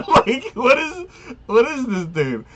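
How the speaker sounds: high, laughing through the words; incredulous giggling question. Real, not acted. Friend mocking the odd hero, laughing high.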